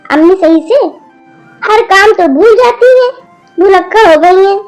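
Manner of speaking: loud complaining daughter. Acted. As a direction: complain hotly to granny. Daughter complains loudly to grandmother. (दादी से ज़ोर से शिकायत करती बेटी)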